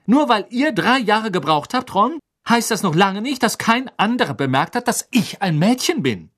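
high, forceful, indignant tirade; indignant anger. A narrator's reading. Hermine scolds Ron for overlooking she's a girl, indignantly. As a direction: tell him off with rising outrage. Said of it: "ihre Augen blitzten gefährlich".

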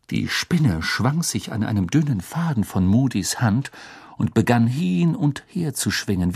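usual speaking voice

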